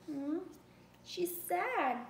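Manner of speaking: usual speaking voice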